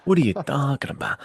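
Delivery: normal talking voice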